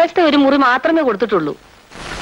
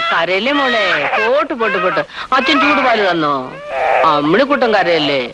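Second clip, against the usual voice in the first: tender comforting; 7 semitones lower than usual. Acted. Woman holding the crying baby, hushing her tenderly and lower. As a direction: gently coax the baby to stop crying.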